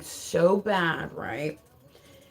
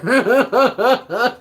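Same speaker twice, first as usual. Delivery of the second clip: real loud belly laugh, higher pitched; distinctive laugh; 5 semitones higher, 6 dB louder than usual. Real, not acted. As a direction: crack up in a run of ha-has. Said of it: "that's an interesting laugh you got there".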